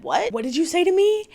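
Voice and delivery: high, indignant what did you say; offended disbelief. Retold or imitated. Mary confronts Angie in retold quarrel.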